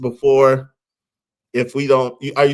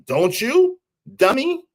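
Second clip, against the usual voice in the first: high teasing; 10 semitones higher, slower than usual. Real, not acted. Teasing chat.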